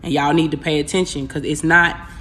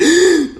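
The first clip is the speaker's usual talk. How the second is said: startled gasp for air; sudden loud gasp; sharp high gasp; 10 semitones higher, 5 dB louder than usual. Retold or imitated. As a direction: gasp as if choking awake. Waking up choking.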